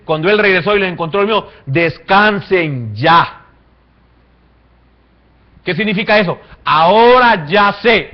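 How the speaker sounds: loud, high sermon shouting; fiery preaching. Acted. Preacher crying out Jesus's warning to Pedro. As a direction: drive the story of Pedro home from the pulpit.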